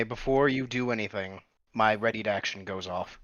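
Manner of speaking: ordinary talk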